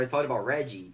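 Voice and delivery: ordinary talk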